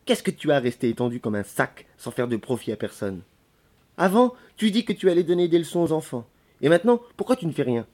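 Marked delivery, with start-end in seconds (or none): 4.0-7.9 higher, reproachful challenge; indignant maid's reproach; scolding. Acted. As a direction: take him to task for idleness. Maid berates idle lodger.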